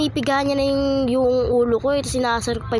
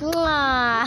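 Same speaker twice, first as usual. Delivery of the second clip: kiss sound; 2 semitones higher than usual. Real, not acted. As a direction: blow a flying kiss. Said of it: "Flying kiss". (malakas na halik sa hangin)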